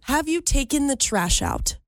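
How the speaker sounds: precise, ladylike enunciation; prim and proper. Pretend. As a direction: say it primly, every word neatly pronounced. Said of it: "Prim and proper".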